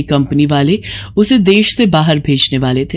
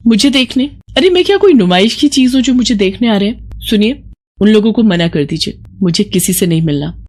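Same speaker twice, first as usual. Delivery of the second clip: higher, offended and scornful; indignant refusal; 4 semitones higher than usual. Acted. Bride refusing to meet visitors, indignant. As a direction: scoff at being put on show.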